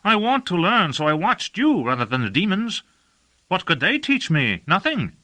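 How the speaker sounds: Sam Salazar's voice. A narrator's reading. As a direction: play the cheeky youth, innocent-sounding. Sam's voice in dialogue.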